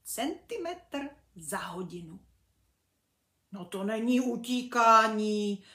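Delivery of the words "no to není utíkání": grumbling, loud bumblebee voice, a little strange; frowning bumblebee. Acted. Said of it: "mračil se Brunda".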